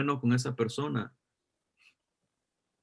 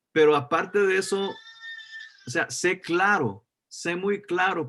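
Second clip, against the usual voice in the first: building preaching; 5 semitones higher than usual. Acted. Pastor urging his congregation to be clear, preaching with force.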